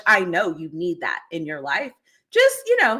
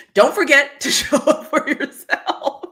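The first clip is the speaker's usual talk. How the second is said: slow, louder, with a laugh mid-line; laughing advice; 5 semitones higher, 3 dB louder, slower than usual. Real, not acted. Host laughing while giving the viewers her closing advice. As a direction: laugh through the advice, drawn out.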